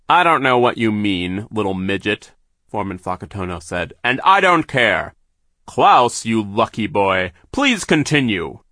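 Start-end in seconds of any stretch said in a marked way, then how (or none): 0.1-2.3 sneering villain. A narrator's reading. Villain foreman voiced in story. 4.0-8.6 slow, loud gloating villain. A narrator's reading. Villain foreman urges Klaus on.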